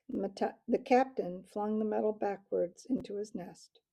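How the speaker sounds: usual speaking voice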